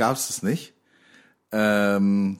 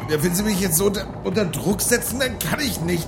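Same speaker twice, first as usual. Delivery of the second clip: whiny higher captain; 9 semitones higher than usual. Acted. Captain Dirk again.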